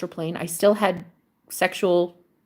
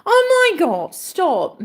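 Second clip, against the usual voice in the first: high, breaking cry of emotion; choked up; 11 semitones higher, 4 dB louder, much slower than usual. Real, not acted. Moved by a sweet viewer comment, voice breaking into tears. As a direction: voice cracking, fighting back tears. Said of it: "You're gonna fucking make me cry".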